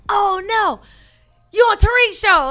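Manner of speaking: high, loud excited fan. Retold or imitated. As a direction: squeal it like a starstruck fan. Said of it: "He was like".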